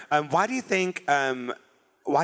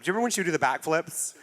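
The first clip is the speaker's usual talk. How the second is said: ordinary talk, nothing marked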